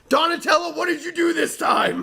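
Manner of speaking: high mock scolding of a turtle; scolding Donatello. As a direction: chide him like an exasperated parent.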